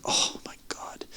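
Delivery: breathless unvoiced whisper, slowed. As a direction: gasp it out under your breath in shock. Shocked discovery, told as whispered reaction.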